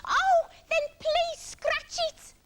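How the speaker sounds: high, loud, drawn-out plea; Iggy begging. A narrator's reading. Iggy begging Parrot for relief, wailing plea. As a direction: beg desperately, stretching the words. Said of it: "begged Iggy".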